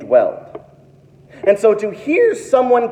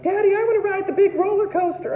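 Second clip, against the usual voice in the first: put-on much higher and fast, a little kid begging; eager kid; 12 semitones higher, 5 dB quieter, faster than usual. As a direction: bounce it out like an excited child. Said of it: "They're like".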